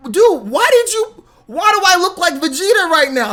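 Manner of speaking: high, loud, indignant complaint; retold outrage. Customer confronting his barber over a ruined hairline, indignant. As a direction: demand an explanation in mock outrage. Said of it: "and it made me mad".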